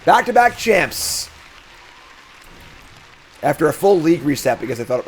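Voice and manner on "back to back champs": proud boast. Real, not acted. Boasting about hockey league wins.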